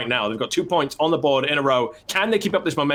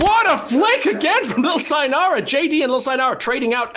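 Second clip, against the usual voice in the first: excited shout; 12 semitones higher, 3 dB louder than usual. Real, not acted. Caster hype on big play.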